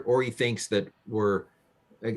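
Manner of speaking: ordinary talk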